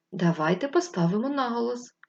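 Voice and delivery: ordinary talk, nothing marked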